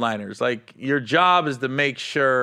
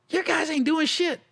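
high-pitched imitation of a scolding; retold cussing out; 13 semitones higher than usual. Club manager cussing out the headliner, imitated scolding. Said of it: "I got cussed out by Casey".

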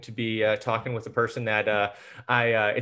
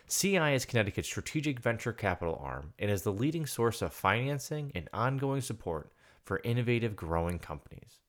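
smooth, even ad read, a little softer; sponsor ad read; 2 semitones lower, 7 dB quieter than usual. Acted. Host reads sponsor message to podcast listeners, smooth ad read. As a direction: read the sponsor spot like a polished promo.

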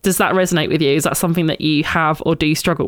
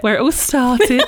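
laughing; 6 semitones higher than usual. Real, not acted. Laughing about where they met.

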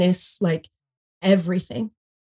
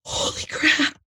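stunned whisper; 8 semitones higher, 4 dB quieter, slower than usual. Retold or imitated. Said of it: "I was just like".

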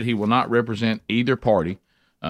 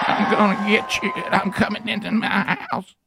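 high creepy horror-movie voice; menacing high pitched monster voice; scary movie villain; 10 semitones higher than usual. Pretend. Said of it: "and this is how it talks".

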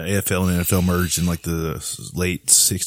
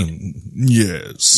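upper crust British. Retold or imitated. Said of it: "rich British guy, the upper crust".

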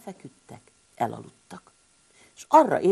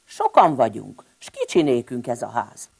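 indignant; 4 semitones lower, 7 dB louder than usual. Acted. Balázs continues his outburst.

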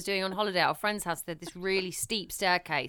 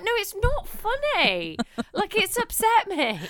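higher, slow, hurt protest; hurt complaint; 11 semitones higher, 3 dB louder, slower than usual. Retold or imitated. Telling husband it isn't funny, mock hurt. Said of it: "I'm like".

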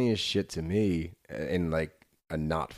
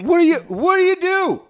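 high shrieking outrage; 13 dB louder than usual. Real, not acted. Friend shrieking at Catherine's reaction, high and loud.

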